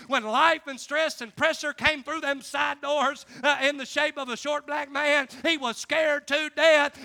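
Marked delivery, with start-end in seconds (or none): none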